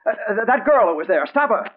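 high, loud urgent yell. Acted. Reporter yells at the window washer to stop her. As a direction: shout to stop him across the air shaft. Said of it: "I yelled at him".

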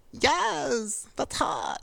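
high, preening mock self-admiration; mock vain admiring. Mocking herself admiring her looks, high vain voice. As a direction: toss it off like admiring yourself in the mirror. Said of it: "Not like".

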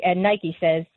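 ordinary talk, nothing marked

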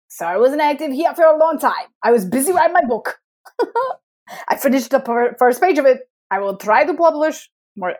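hammed-up Gru and Borat style foreign accent; comic accent, a little Gru, a little Borat. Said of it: "Gru from".

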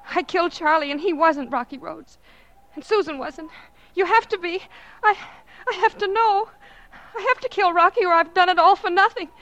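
a killer unraveling as she confesses; frantic raving. Acted.

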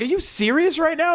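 high, disbelieving question; incredulous. Retold or imitated. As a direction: ask it like you can't believe her. Incredulous on phone.

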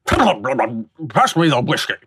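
slow, lightly voiced old gentleman; a hint of a stuffy mustached man; laughing mock old-timer asking for whiskey; walrus-mustache gent. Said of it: "my big, big walrus mustache, man".

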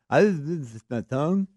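lightly voiced boy, words thick from a bitten tongue; kid talking with bitten tongue. Dad imitating his son's bitten-tongue lisp. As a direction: mimic a kid lisping around a sore tongue. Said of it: "when I say he's talking like that".

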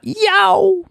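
high yelp of a snap; sharp yelp. Retold or imitated. Voicing the snap that bursts out of Will. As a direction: let out a sudden high "Yow".

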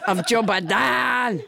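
blustering mock-French proclamation; French Trump. French Trump threatens Biden and Pelosi, blustering French accent. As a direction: declaim it with absurd French vowels. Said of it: "your extremely realistic accent".

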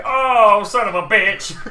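loud, high howl of protest; hammed-up outraged cry; pained yell. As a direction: yelp it as if yanked by the hair. Guard lifted up by his hair.

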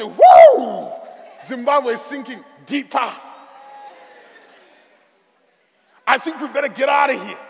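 mock panic. Soldiers panicking in chatter.

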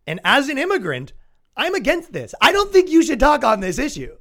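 high, loud hammed-up protest; mock righteous objection. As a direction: build to an outraged, high-pitched objection. Acted argument as the offended immigrant.

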